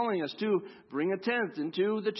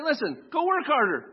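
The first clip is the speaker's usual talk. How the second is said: mock stern lecture; 9 semitones higher, 5 dB louder than usual. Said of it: "it allows us to say".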